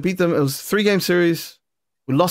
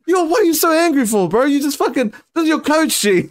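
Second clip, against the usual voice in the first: much higher, fired-up mock scolding; retold indignant call-out; 9 semitones higher than usual. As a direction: tell him off half laughing. Retells scolding angry teammate.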